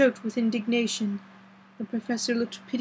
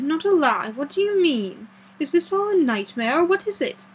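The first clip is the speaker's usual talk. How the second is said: higher, agitated questions; horrified disbelief; 6 semitones higher, 3 dB louder than usual. A narrator's reading. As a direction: plead for an explanation in alarm.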